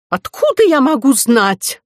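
high, loud indignant retort. A narrator's reading. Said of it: "возмутилась директриса". (возмущённо, на повышенных тонах)

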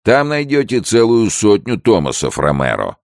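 loud, high-pitched taunt; jailer calling out. Acted. Jailer's assistant shouts advice after old man, calling out.

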